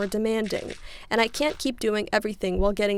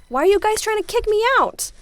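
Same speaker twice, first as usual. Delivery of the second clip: whiny accusation; 11 semitones higher, 6 dB louder than usual. Retold or imitated. Roommate spirals, accusing boyfriend.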